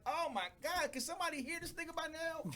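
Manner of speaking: high, exasperated aside. Retold or imitated. Fed up with hearing the catchphrase, high and exasperated. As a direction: roll your eyes at it. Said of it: "I'm like".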